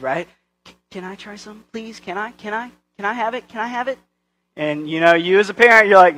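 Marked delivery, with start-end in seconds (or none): 0.7-4.0 soft, higher, rapid-fire begging questions; imitating eager child. Child begging parent to try vanilla extract, mock pleading. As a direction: pester like a small hopeful kid. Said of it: "they get that attitude".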